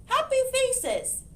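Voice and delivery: high excited hen cry. Acted. Hen seeing happy faces at the library, high cluck. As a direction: cluck it out gleefully. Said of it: "clucked the hen".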